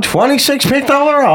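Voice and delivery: very high-pitched announcer imitation; hammed-up broadcast voice; old-time announcer. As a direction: call the pick like vintage radio. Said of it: "like, an announcer's voice".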